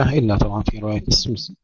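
ordinary talk

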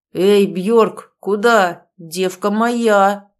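put-on loud slurred drunk growl; drunken growl. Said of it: "пьяным голосом рычит Ангус". (пьяный рык)

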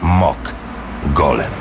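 formal radio announcer intro; announcer read. Acted. Radio announcer introducing an audiobook, formal.

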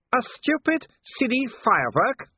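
high, shouted taunt at the enemy. A narrator's reading. Werewolf yelling at Vimes before he charges, high mock shout. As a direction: yell it up high, mocking. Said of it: "shouted Wolfgang".

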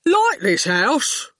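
slow, heavy-witted thug, words dropped one by one; menacing henchman taking his time; slow lumbering thug; slow goon voice. Acted.

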